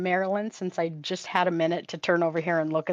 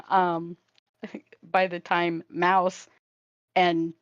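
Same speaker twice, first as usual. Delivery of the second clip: normal talking voice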